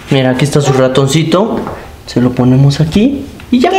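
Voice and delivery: normal talking voice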